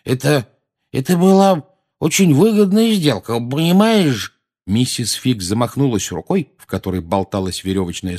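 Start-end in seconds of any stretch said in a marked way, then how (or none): 0.1-4.4 uneasy excuse-making. A narrator's reading. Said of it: "Наземникусу было чрезвычайно не по себе".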